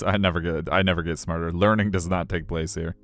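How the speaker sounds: normal talking voice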